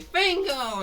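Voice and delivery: "Fango!" cried out high and loud, falling at the end; excited call of "Fango!"; crowd cheer. Retold or imitated. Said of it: "They say".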